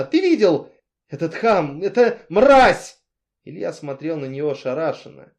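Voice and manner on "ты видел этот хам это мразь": loud high shout, the last word drawn out slowly. A narrator's reading. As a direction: scream the insults, slow on the end. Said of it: "закричала она".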